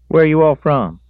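put-on high, loud young country voice; country boy voice. A narrator's reading. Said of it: "the boy said".

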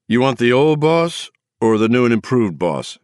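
put-on slurred drunk answering the phone; a tipsy man picking up. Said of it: "the drunk voice".

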